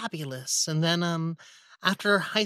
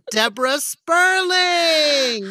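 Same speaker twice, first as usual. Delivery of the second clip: announcer intro; 9 semitones higher, 6 dB louder, slower than usual. Pretend. Host announces guest grandly.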